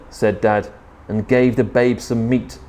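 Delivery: usual speaking voice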